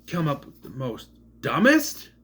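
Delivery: ordinary delivery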